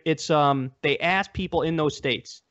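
ordinary delivery

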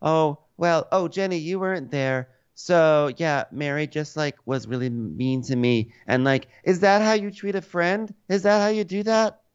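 high, whiny imitation of a wounded friend; hammed-up hurt complaint; mock Whitney whine. Host imitating Whitney complaining to Jenny, high and whiny.

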